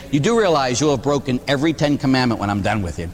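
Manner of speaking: ordinary talk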